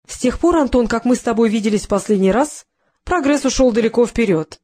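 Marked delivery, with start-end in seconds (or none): none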